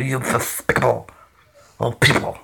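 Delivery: soft cartoon duck grumble; Daffy Duck voice. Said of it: "Yes, I'm doing a Daffy Duck impression".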